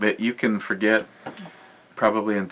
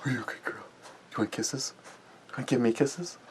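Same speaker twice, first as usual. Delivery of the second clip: talking to a dog; 3 semitones higher, 8 dB quieter, faster than usual. Real, not acted. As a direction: coo softly to a pet dog. Cooing to the dog.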